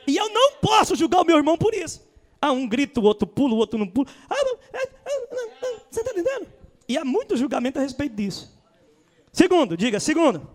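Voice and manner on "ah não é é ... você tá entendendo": mock timid brother. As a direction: play the shy one who won't jump. Mocking someone's hesitant reaction. (imitando um irmão tímido)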